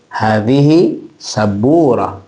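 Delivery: slow, level statement falling at the end; "Hadhihi sabura" drawn out, ending on a fall; straight informative intonation. Shown as a demonstration. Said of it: "Informasi itu lurus".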